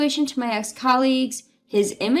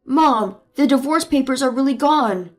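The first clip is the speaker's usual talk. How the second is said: panicked husband; 4 dB louder than usual. Acted. Husband telling his mother the papers are gone, loud and panicked. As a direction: blurt it out to mom in alarm.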